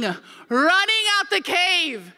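high, drawn-out cry; slow, heightened exclamation; dramatic emphasis. Retold or imitated. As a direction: stretch it out for comic drama.

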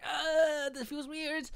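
high-pitched nervous squeal, acted; imitated high, awkward whine; mock awkward panic. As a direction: squeak it out like a first-timer's nerves. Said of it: "you're always like".

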